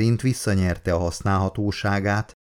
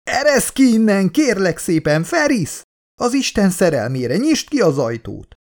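much higher, louder desperate pleading scream; hysterical screaming; 10 semitones higher, 4 dB louder than usual. Acted. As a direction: beg to be let out at full voice. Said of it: "üvölteni kezdett". (kétségbeesett, magas hangú üvöltés)

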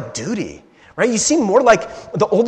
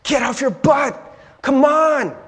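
mock a busy sister scolding the lazy one; scolding Martha type; 7 semitones higher than usual. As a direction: Martha type telling Mary to get moving. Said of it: "we're like".

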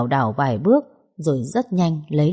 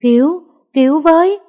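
much higher, much louder cry for help; calling for help; 12 semitones higher, 8 dB louder than usual. Acted. Wife at the gate calling neighbours for help, desperate shout. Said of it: "chạy ra khỏi cổng kêu lên".

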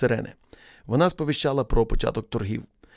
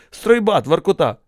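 barked posting; 6 semitones higher, 6 dB louder, slower than usual. A narrator's reading. Officer barks a posting.

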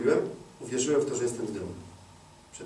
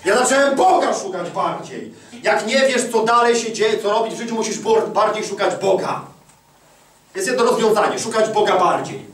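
preaching at full force; 9 semitones higher, 6 dB louder than usual. Acted. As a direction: drive the point home to the congregation. Preaching climax to congregation.

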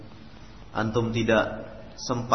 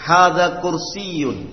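loud, clear, deliberate Arabic vocabulary reading; model reading drill; 5 semitones higher, 5 dB louder, slower than usual. Shown as a demonstration. Teacher modelling Arabic words for students, loud and clear. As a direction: read each phrase out crisply for the class to copy. Teacher leads class repetition.

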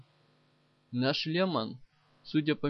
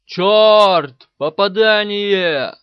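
full-force alarmed yell; battle cry of a tank driver under fire; 5 semitones higher, 17 dB louder, much slower than usual. A narrator's reading. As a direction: shout it out loud and high, drawing out the last word. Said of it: "заорал Каблуков".

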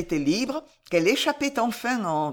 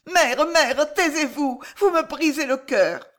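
higher, urgent daughter's plea; anguished plea; 8 semitones higher, 5 dB louder than usual. A narrator's reading. Loïse begs her mother to be silent, anguished. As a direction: beg her to stop, distressed.

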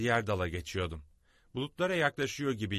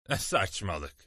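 ordinary delivery

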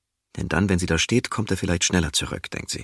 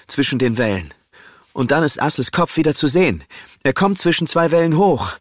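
louder, urgent storytelling that drives the action forward; tense story narration; 7 semitones higher, 8 dB louder than usual. A narrator's reading. Narrator telling Brita's fight through the waves, urgent narration. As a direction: read it like the rescue is happening right now.

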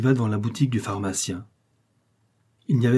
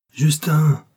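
louder, higher impatient call; impatient shout; 6 semitones higher, 6 dB louder than usual. A narrator's reading. Apothecary calls his apprentice Justin, impatient shout. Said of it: "cria l'apothicaire qui s'impatientait".

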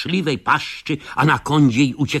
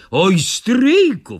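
slow, high mock fright; frightened; 7 semitones higher, 4 dB louder, much slower than usual. Scared Józek pleads with his uncle, drawn-out mock fright. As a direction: hold the oh in a little fear. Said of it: "wystraszył się on".

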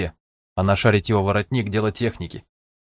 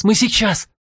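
much higher, stress on Мы; high urgent cry; 12 semitones higher than usual. Acted. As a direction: push the word Мы high. Urgent reassurance.

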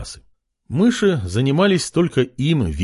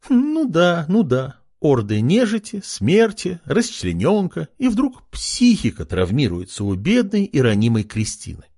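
насмешливо; 6 semitones higher than usual. A narrator's reading. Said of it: "Хмыкнула девушка".

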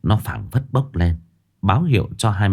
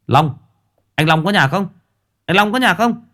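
calling out; 11 semitones higher than usual. A narrator's reading. Someone calling at the door.

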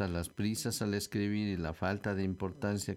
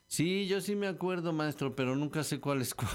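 higher-pitched schoolkid answering teacher; mock pupil, voice raised; eager pupil; 6 semitones higher than usual. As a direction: pipe up like a confessing pupil.

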